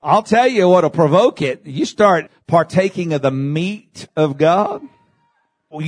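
ordinary talk

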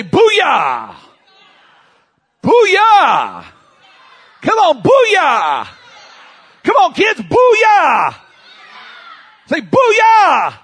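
high, loud drawn-out holler; rallying shout. Real, not acted. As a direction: whip the crowd into a war cry. Leading congregation in a shouted cry.

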